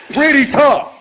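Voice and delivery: loud, high, bitter shout; furious shout. Real, not acted. Owner shouting bitterly to viewers about his wrecked store, furious. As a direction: throw the words out in bitter fury. Sarcastic outburst about the break-in.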